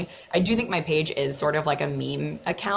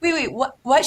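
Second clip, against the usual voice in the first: higher startled double take; mock surprise; 10 semitones higher, 5 dB louder than usual. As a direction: act caught off guard by her. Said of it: "I'm like".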